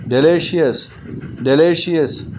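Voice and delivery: Delicious twice, weight on ली; stress on LI. Shown as a demonstration. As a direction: lean on the second syllable ली in delicious. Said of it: "ली जो है second".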